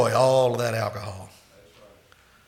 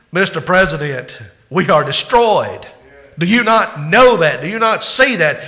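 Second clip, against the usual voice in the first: louder, higher, building outrage; angry counselors; 4 semitones higher, 7 dB louder than usual. Acted. Advisers rebuking the president, rising anger. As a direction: hammer each question harder. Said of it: "as the counselors are pouring this in".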